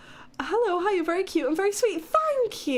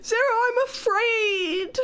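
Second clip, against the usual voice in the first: mock wail; 6 semitones higher than usual.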